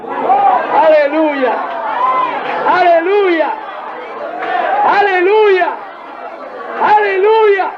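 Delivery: rising aleluyas. Real, not acted. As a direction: climb step by step in fervent praise. Preacher's praise climbing each time.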